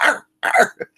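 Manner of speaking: high, whiny imitated bark; whiny dog bark. Dog whining at the door to be let in, high whiny bark. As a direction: yip like a dog begging to come in. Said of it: "the whiny bark".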